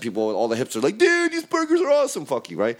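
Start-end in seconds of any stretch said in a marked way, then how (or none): 1.0-2.3 much higher put-on hipster voice; mock hipster. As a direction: ham up a gushing hipster. Imitating hipsters praising burgers.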